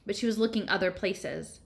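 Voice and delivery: ordinary delivery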